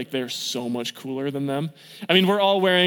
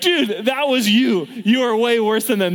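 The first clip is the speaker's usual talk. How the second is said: higher, louder, slow exasperated ribbing; mock exasperated retort; 6 semitones higher, 4 dB louder, slower than usual. Leaders teasing senior Zach, loud exasperated ribbing. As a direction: call him out, half laughing. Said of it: "It's like".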